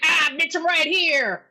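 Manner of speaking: loud, high jump-scare yell; hammed-up monster outburst; mock villain shout. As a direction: leap out and shout like Freddy. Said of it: "So Freddy jumps up. He's like".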